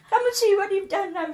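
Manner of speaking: high, loud indignant summons; emphatic mock telling-off; retold scolding. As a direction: act out an outraged neighbour calling someone out. Neighbour's scolding repeated.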